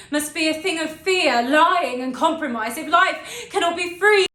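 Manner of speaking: building from contained scorn to loud outcry; defiant dramatic monologue. Acted. Rejecting a humdrum life of compromise, defiant, mounting theatrical speech.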